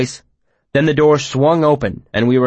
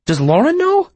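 sharply rising, alarmed question; worried, high disbelief. Acted.

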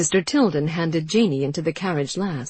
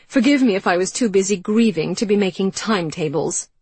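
loud, higher, bitter; bitter retort; 4 semitones higher, 6 dB louder than usual. Acted. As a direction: throw the grief back in her face.

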